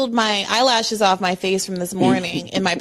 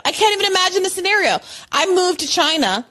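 normal talking voice

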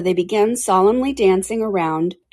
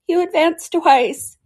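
high, loud Griffin call; crying out; 8 semitones higher, 4 dB louder than usual. A narrator's reading. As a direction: call out the dance steps, pitched up. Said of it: "cried the Griffin".

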